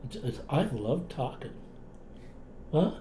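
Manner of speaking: normal talking voice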